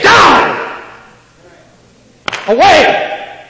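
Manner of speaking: sudden high shout at the idols; commanding shout. Pretend. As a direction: cast them out with a roar. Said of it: "look your idols in the eye and say".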